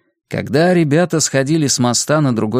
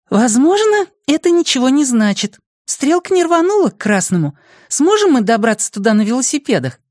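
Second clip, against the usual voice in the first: very high-pitched girl voicing doubts; high girlish voice, cautious; doubtful girl; 13 semitones higher than usual. A narrator's reading. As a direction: pitch it way up as a girl weighing the odds.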